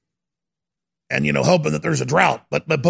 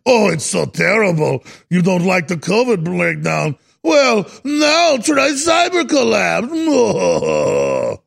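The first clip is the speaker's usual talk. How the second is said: mock German accent; slower than usual. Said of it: "I do more of a Russian accent than a German".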